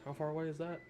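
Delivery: usual speaking voice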